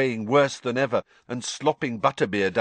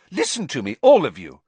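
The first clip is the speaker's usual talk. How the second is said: high-pitched indignant lecture; heated, raised-pitch telling-off; angry; 11 semitones higher, 3 dB louder than usual. A narrator's reading. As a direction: rouse them with cross insistence. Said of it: "said Hermione angrily".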